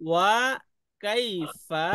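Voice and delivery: "ওয়া কাইফা" very slow, with gaps; slow syllable-by-syllable "ওয়া কাইফা"; broken into syllables. Shown as a demonstration. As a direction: separate "ওয়া", "কাই" and "ফা" for the class. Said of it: "এইভাবে একটু ভেঙে ভেঙে বললাম".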